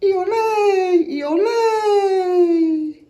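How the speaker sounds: high, loud sung bird call; evening bird song; wood thrush song. Acted. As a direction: sing it like a thrush at dusk. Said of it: "Wood thrush turns the twilight".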